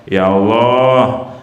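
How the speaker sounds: slow, low, pleading prayer to God; a demonstration of a humble petition; humble istikharah prayer. Shown as a demonstration.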